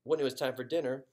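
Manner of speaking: ordinary delivery, nothing marked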